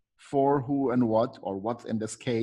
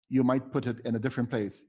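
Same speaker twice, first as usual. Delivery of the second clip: ordinary talk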